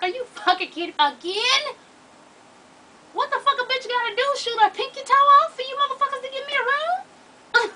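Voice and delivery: loud, high, disbelieving rant; exasperated outburst. Retold or imitated. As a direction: yell it at the staff, fed up.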